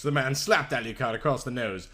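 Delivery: usual speaking voice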